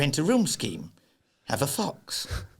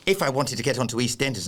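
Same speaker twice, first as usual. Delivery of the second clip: normal talking voice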